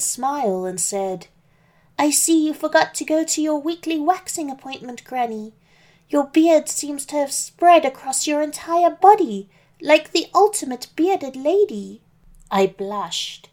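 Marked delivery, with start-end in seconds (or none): 2.0-12.0 put-on little girl voice. Little Red voiced, higher.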